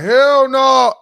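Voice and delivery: high, loud disbelieving "hell no"; sharp high refusal; mock "hell no" outburst. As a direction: blurt it out, high and loud, like you refuse outright. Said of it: "I was like".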